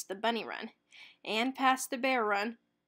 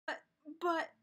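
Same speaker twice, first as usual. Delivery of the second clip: stammering; 6 semitones higher, 3 dB quieter than usual. A narrator's reading. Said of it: "Shaggy stammered".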